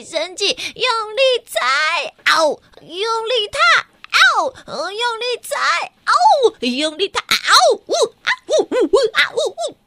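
a small lion cub stamping and howling to chase the anger off; a storyteller's cub stomps and howls at the rocks to drive out its anger; little lion roaring. Acted.

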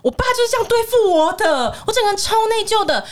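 high-pitched, plaintive mock wail; guilt-stricken. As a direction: lament it as the helpless victim. (委屈又内疚地哀嚎)

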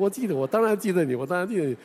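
high, loud eager assurance; retold excited reply; delighted reply. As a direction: reassure her with delight. Pastor answers her warmly.

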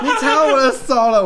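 high loud yell cracking into laughter; laughing mock yell. Man whose fingers she stepped on, laughing mock yell. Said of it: "他直接大叫啊".

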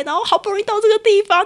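choked-up retelling. Retold or imitated. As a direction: relive the frustration, voice rising. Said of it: "我就一个哽咽". (哽咽着、声音拔高)

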